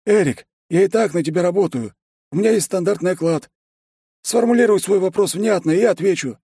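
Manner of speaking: high excited cheerful voice; Ben's voice. Acted. Ben replies in his voice.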